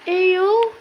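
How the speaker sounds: high, loud startled eyo; a sudden yelp of surprise; surprised. Real, not acted. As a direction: caught off guard by what he sees. Startled at a sight in game.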